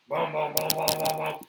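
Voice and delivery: loud thudding booms, stomp out each one; imitated pounding footsteps. Host retelling footsteps on the stairs, loud thudding booms. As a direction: mimic heavy men running down stairs, loud.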